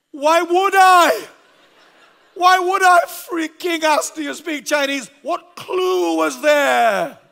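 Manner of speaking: loud, high, slow incredulous yelling; exasperated shout. Retold or imitated. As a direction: bellow your disbelief at him. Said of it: "To which I said".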